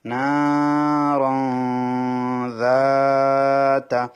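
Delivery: নারান যাতা chanted slowly, tanwin held in the nose; slow tajweed chant; ikhfa nasal hold. Shown as a demonstration. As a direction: draw out নারান then hide the n before যাতা. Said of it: "এখানে এক আলিফ টানতে হবে".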